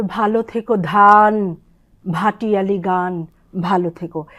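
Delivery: ধান with a breathy, slow ধা; slow recital, stretch ধান; drawn-out dhan. Shown as a demonstration. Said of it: "একটু টেনে ড্রল করে বলতে হয়". (ধান টেনে আবৃত্তি)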